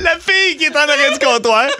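high laughing words; giggling at his own fumble. Real, not acted. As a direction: laugh through it, embarrassed.

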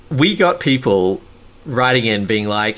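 ordinary delivery

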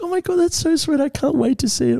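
high-pitched gushing imitation; mock-girly excitement; excited girlfriend. Voices girlfriend thrilled about the surprise.

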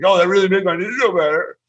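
high, whiny mocking voice; mock a petulant whiner. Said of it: "in that whiny voice".

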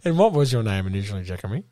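low, measured company spiel; corporate spokesperson. Acted. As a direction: recite the official policy. Low deadpan manager voice.

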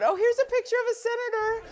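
high, bright-eyed selfie chirp, a bit fast; playfully mock a tourist snapping a selfie. Senator mocking selfie-seekers at airports, high playful chirp. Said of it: "put it on the selfie mode".

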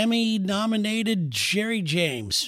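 tongue-in-cheek grand announcement; mock show announcer. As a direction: draw out the name like an emcee. Mock introduction of co-host.

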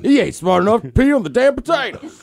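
lightly voiced cantankerous old man; old guy. Radio host riffs on what an old guy would say, lightly voiced old man. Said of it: "an old guy would say".

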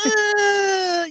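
long, high-pitched hesitant "uh"; stalling high "uhhh"; reluctant uh. Acted. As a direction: draw out a high, awkward whine of reluctance. Reluctant groan at approaching celebrity.

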